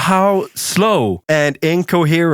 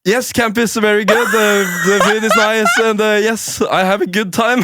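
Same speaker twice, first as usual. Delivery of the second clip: stiff nervous interview voice; stilted and halting, on camera; awkward stiff answers; 9 semitones higher than usual. Retold or imitated. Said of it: "I just can't fucking make sense".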